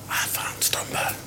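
hushed, breathless complaint; mock whisper. Keeper excusing himself to teammate, whispered. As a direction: whisper it like a hurt man faking it.

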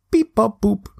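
high beeping robot sounds. Retold or imitated. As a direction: beep it out like a toy robot. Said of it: "more like a robot".

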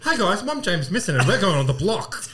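loud, high, laughing vlogger greeting; laughing hammed-up vlogger; mock vlogger intro. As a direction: blurt it like a chaotic influencer intro through laughter. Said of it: "worst thing I've ever done".

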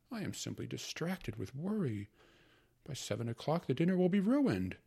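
high, loud fussing mother; fretting mother. Acted. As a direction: fuss like a harried housewife. Mother's voice in story.

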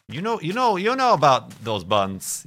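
high, loud sing-song tease; playful mock-accusing lilt; teasing. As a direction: draw it out like a knowing tease. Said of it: "teasing exaggerated delivery".

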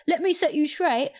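high, slow, defensive correction. Acted. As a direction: act out putting someone straight. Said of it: "would have got really defensive".

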